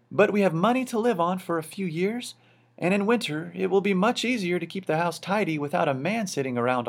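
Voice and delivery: a practical wife, fond but unfussed; Missus Popper. A narrator's reading.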